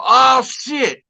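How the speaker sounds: high startled outcry; mock alarm. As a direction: blurt it like a caught kid. Realizing the turtle still there.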